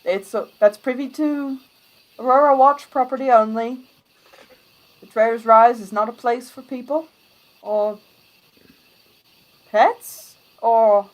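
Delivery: loud, firm gate guard; stern guard. Acted. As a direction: bar the way like a soldier.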